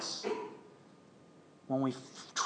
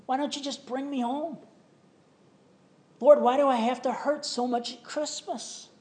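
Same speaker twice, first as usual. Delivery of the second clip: higher, pleading cry to God; a grieving believer begging God for answers, pitched higher; anguished plea to God; 7 semitones higher, 3 dB louder than usual. Acted. Sufferer crying out to God.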